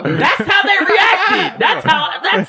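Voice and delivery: high laughing insistence; laughing shout. Real, not acted. Defending the story to friends, laughing shout. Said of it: "laughing while insisting".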